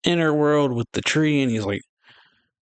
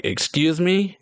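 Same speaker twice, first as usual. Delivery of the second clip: higher-pitched, affronted question; mock indignation; 7 semitones higher, slower than usual. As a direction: act out being taken aback. Retold character takes offense.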